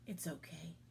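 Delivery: soft, low comforting words; reassuring. A narrator's reading. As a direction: hush a crying child during a hug. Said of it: "giving him a hug".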